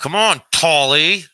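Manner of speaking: loud, high, drawn-out goading call. Real, not acted. Speaker urging Polly to join in, calling out. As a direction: holler it out slowly to urge someone on.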